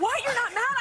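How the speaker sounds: high, disbelieving question; surprised. Retold or imitated. Imitating castmate shocked she isn't mad, high and incredulous. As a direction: sound amazed she isn't upset. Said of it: "He goes".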